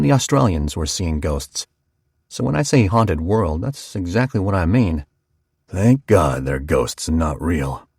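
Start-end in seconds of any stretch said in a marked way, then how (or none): 5.7-7.9 low slow barber. A narrator's reading. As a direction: lower and slowed, a rough barber's growl. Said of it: "Clyde said".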